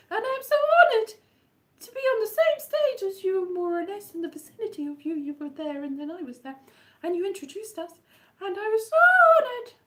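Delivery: high, breathless fangirl gush; gushing fan. Pretend. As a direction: gush at your hero, laughing. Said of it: "But I did not say that".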